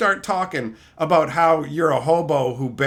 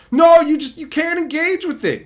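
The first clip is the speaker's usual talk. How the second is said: mock commenter; 8 semitones higher, 5 dB louder than usual. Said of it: "Magoos complaining".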